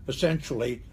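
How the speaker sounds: ordinary talk, nothing marked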